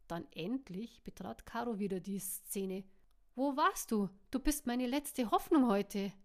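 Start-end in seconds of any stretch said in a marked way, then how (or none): 3.3-6.1 loud. Acted. M greets Caro in busy bar.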